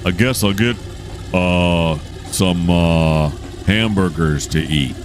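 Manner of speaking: mock American accent with drawn-out fillers; put-on American accent. Host jokingly plans a meal, put-on American accent. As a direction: lay on a hammy American accent, halting between words. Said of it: "that's my American accent right there".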